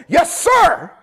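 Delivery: high, loud military-style yes sir; mock salute reply. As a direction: snap it off like a mock cadet. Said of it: "And I went like this".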